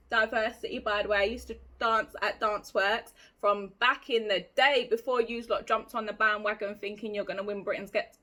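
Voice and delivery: ordinary talk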